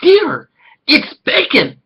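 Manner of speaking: high, slow, delighted mom voice; excited mother. Retold or imitated. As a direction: squeal it to her husband like she found treasure.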